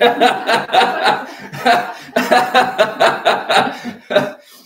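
loud high breathy laugh. Real, not acted. Pastor laughing at his own joke, loud laugh. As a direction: burst out laughing.

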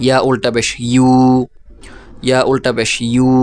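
closing হু, বু, ইউ each held long; long madd vowel. Shown as a demonstration. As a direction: spell evenly, then stretch the final হু, বু or ইউ. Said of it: "এইভাবে".

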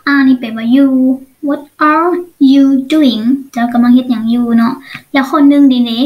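ordinary talk, nothing marked

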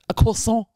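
croissant said the French way, kwah-SON; croissant with a French nasal ending. Retold or imitated. Mom correcting her daughter at the store, croissant in French. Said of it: "you are so French".

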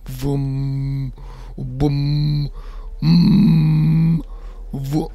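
playful vroom engine sounds; engine noises. Pretend. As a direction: make the car go vroom. Making car noises while driving.

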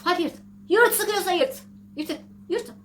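loud, hammed-up taunt to rip the contract; taunting dare. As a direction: goad them, loud and defiant. Acting out employer, taunting dare.

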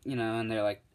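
ordinary talk, nothing marked

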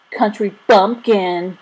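drawn-out playground jeer; jeering yell. A narrator's reading. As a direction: stretch out the insult and call it across. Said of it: "Ben yelled again".

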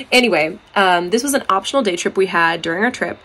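ordinary talk

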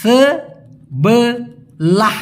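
সেবেলাহ stretched very slowly with a rising end; drawn-out demo. Shown as a demonstration. Teacher stretches the word for learners. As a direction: draw out সেবেলাহ long, ending up. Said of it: "অথবা সেবেলাহ".

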